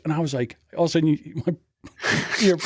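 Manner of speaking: usual speaking voice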